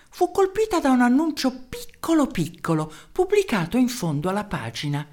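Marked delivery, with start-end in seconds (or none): none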